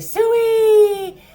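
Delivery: a long, very high, loud hog call, slow; hog-calling holler. Pretend. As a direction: holler it like calling pigs across a farm. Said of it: "Like that".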